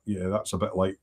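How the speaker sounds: ordinary talk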